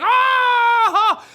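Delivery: high, loud hammed-up scream; mock scream. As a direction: let out a comic yell of fright. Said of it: "And I was like".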